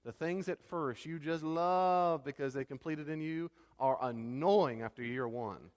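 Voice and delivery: usual speaking voice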